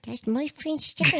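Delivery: very high talking-dog voice; Obi. Acted. Host voices another dog about a friend, high silly dog voice. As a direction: pipe up high as a pet dog. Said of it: "And Obi's voice would be".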